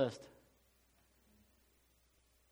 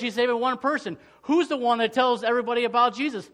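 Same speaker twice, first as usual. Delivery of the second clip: louder, higher preaching, pressing every phrase; full-force preaching; 6 semitones higher, 6 dB louder than usual. Acted. Pastor preaching to his church, full force and raised. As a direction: drive the point home to the congregation.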